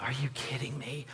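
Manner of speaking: whispered "Are you kidding me?" under the breath, no voice; a hushed, unvoiced groan of disbelief; whispering "Are you kidding me?" alone in the car. Seeing police lights ahead.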